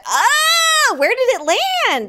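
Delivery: high, loud shriek of alarm; high-pitched mock panic; panicked cry. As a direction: yelp as if it's on you. Listener imagines spider on her.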